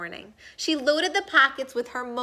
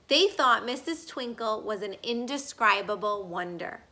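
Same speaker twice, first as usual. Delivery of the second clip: ordinary delivery, nothing marked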